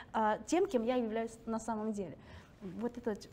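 ordinary delivery

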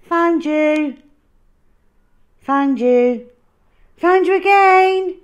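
gleeful calling. Acted. Hal finding the dinosaur, gleefully loud. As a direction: crow it out triumphantly.

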